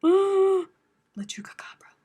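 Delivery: sharp gasp, then a high hushed "La Chupacabra"; hammed-up spooky gasp and whisper; gasped mock-horror whisper. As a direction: whisper "La Chupacabra" as if the monster is here.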